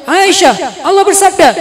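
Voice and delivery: loud, high mock-authoritative announcement; stern proclamation. Husband answering his wife with scripture, sternly proclaiming. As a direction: proclaim it as a commanding rebuttal.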